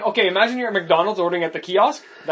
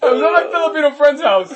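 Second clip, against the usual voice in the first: louder, higher, cracking up; laughing; 8 semitones higher, 7 dB louder than usual. Real, not acted. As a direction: push the story out through laughter. Restarting story amid group laughter.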